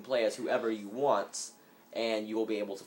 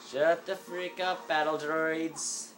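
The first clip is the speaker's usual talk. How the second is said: higher, slow, stretched-out telling off; mock angry yell at droids; 6 semitones higher, slower than usual. Gamer telling off battle droids, mock angry. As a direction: scold the droids like a fed-up parent.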